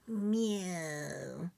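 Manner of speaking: soft, drawn-out rising meow; a sulky little cat's meow. Acted. Kitty answers.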